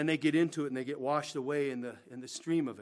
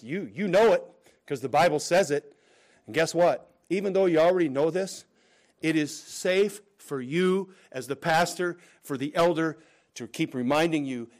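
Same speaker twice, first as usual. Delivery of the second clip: loud, pressing sermon voice; insistent preaching; 3 semitones higher, 8 dB louder than usual. Real, not acted. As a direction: urge the congregation firmly.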